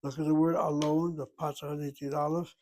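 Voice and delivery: normal talking voice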